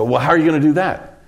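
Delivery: mock disbelieving question; retold doubtful challenge. Retold challenge to a suggested cut.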